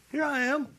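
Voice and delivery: put-on eager high boyish voice; young Samuel answering. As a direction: answer like a boy woken by a call. Boy Samuel answers Eli.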